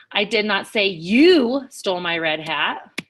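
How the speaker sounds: single out "you", then lift the ending; stress on YOU. Shown as a demonstration. Teacher shows students another implied meaning, stress on "you". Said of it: "make each word have a different feeling".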